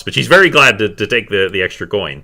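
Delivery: normal talking voice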